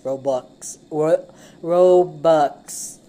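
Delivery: Robux drawn out slowly and loud; slow careful Robux. Shown as a demonstration. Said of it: "Well, Robux".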